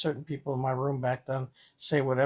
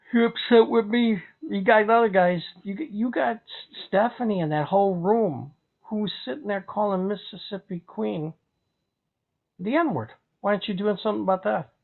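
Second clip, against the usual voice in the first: angry outburst; 5 semitones higher, 4 dB louder than usual. Real, not acted. Angry rant at critics.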